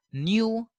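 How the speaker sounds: y glide after the n in New; British pronunciation. Shown as a demonstration. Teacher showing the British form, New as "nyoo". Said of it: "while these people say no".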